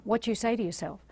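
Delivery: usual speaking voice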